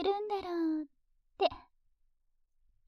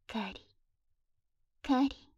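usual speaking voice

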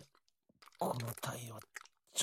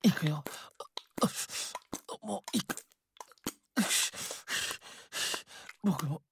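loud, breathless climax cries; 3 semitones higher, 4 dB louder than usual. Acted. Lover announcing his climax to his partner, loud breathless cries. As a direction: let it break out as you climax.

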